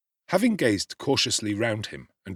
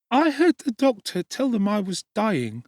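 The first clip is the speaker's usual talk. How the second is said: put-on much higher, louder little child's voice; small child Dick; 13 semitones higher, 4 dB louder than usual. A narrator's reading. Sick little Dick answering Oliver, high child voice. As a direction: answer as a frail small boy. Replied the child with a faint smile.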